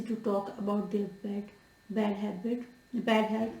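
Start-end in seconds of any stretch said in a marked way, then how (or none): none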